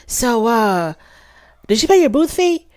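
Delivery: mocking his offhand question, pitched high; high mock of a rude vendor demand; curt question. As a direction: play Marceau asking the fee as if it's owed. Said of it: "he was like".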